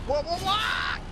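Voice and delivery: high, loud startled cry; alarmed shout of surprise; startled yelp. Real, not acted. Car crashes during driving.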